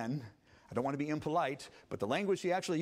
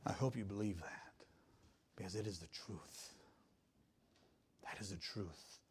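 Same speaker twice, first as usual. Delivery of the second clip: hushed earnest; 8 semitones lower, 12 dB quieter than usual. Real, not acted. Quiet earnest aside to congregation.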